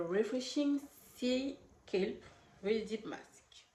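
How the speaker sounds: slow, careful attempt at an English accent. Shown as a demonstration. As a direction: read the brand name in an English accent, not quite managing it. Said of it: "j'essaye, hein, de faire l'accent anglais".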